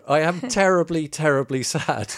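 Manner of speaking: high, loud comic self-pity; mock despair. Real, not acted. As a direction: ham up the sadness with a laugh. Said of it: "mock self-pity, laughing".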